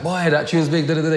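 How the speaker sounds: big up the tune, excited; imitating an excited friend backstage; hyped-up retelling. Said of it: "[name]'s like".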